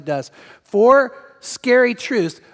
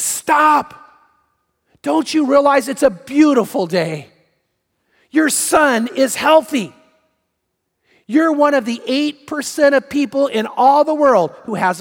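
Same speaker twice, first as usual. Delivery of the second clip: raised, higher-pitched acted scolding; fed-up outburst; 4 semitones higher than usual. Another dad tells off the ranting father, fed-up outburst. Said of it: "another dad had had it".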